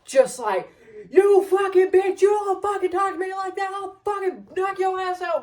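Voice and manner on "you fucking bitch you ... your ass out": retold raging rant. Uncle screaming at Amanda over the car, raging mock shout. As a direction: tear into her like a furious bully. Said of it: "he just starts going off on her".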